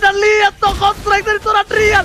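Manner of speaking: hyped caster. Real, not acted. Caster hypes teamfight moment.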